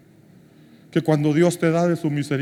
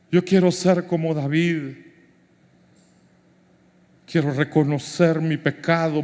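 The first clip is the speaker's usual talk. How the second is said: prayer; 2 semitones lower than usual. Acted. Leads the congregation in prayer.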